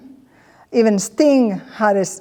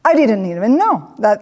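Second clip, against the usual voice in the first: usual speaking voice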